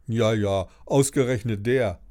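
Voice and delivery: ordinary delivery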